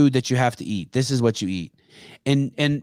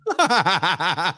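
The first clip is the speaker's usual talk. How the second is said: laugh; 6 semitones higher than usual. Real, not acted. Laughing at the joke.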